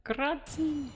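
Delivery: Italian accent. Shown as a demonstration. Showing off the witness's Italian thanks, playful Italian accent. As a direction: echo it as a hammed-up Italian. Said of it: "he's definitely Italian".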